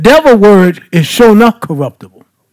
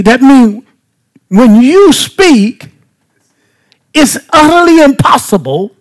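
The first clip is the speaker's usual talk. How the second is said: ordinary delivery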